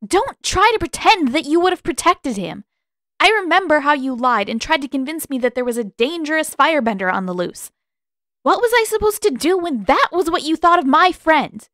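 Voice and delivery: high, loud heated protest; Katara's voice rising high and loud; rising anger. A narrator's reading. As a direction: let the voice creep up in anger. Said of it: "voice creeping upward".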